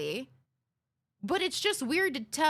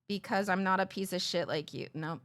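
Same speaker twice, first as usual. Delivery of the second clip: normal talking voice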